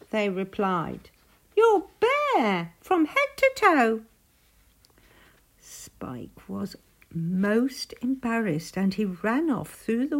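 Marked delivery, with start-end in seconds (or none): 1.6-4.0 high, loud rabbit chorus voice; high-pitched animal taunt; jeering rabbits. A narrator's reading. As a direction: call it out in a squealing, teasing voice. Rabbits jeer in story.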